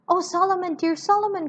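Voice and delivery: a sister overjoyed to find her brother; crying out. Acted. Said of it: "cried Miss Pross".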